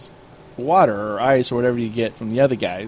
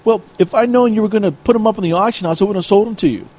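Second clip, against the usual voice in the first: imitating an annoyed player, higher pitched; retold angry complaint; 6 semitones higher than usual. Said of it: "he's getting mad at me".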